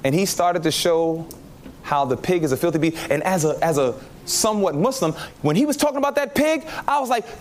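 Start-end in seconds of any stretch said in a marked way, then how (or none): none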